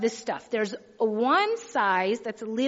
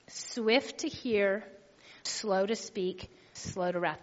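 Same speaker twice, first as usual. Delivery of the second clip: each phrase slow and softer, dropping lower; slow, broken down; 3 semitones lower, 5 dB quieter, slower than usual. Shown as a demonstration. Pastor breaking down James one nine for the church, slow and soft. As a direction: spell it out slowly, phrase by phrase. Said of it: "I'll break it down like this".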